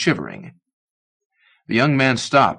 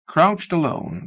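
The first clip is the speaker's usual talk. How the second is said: ordinary delivery, nothing marked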